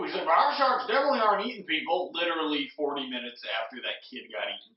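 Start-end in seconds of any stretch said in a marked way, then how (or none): 0.3-2.1 louder, higher, rapid-fire objection; retold protest. The chief argues with the mayor, loud retold protest. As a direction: protest quickly in mock indignation. Retold argument over sharks.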